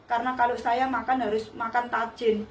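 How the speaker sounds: ordinary talk, nothing marked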